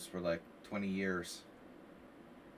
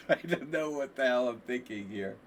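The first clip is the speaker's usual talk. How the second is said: laughing; 5 dB louder than usual. Real, not acted. Laughs at own idea.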